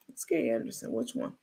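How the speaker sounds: soft unvoiced whisper; whispered aside. Real, not acted. Host whispers the name to her viewers.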